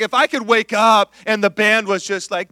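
normal talking voice

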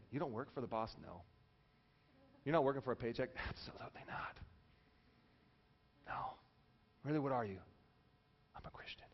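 very hushed, low secret exchange; near-whisper it, as if undercover; mock conspiratorial whispering; covert agent. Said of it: "it's top secret".

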